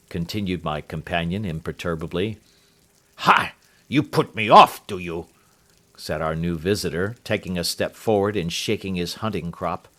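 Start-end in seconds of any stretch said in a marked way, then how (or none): 3.2-5.3 loud scornful bark; furious outburst. Acted. Angry visitor shouts at Holmes, furious. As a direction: explode at being put off.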